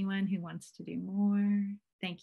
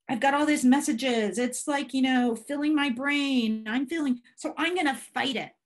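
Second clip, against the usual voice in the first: raised, worked-up inner voice; mock agitated self-talk; 5 semitones higher, 6 dB louder than usual. Speaker acting out fighting inner messages, mildly agitated raised pitch. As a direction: play someone lightly overwhelmed and ready to fight.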